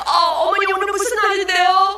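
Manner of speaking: high, loud acted stammered question; flustered question. Evangelist to the cursing grandmother, high flustered question.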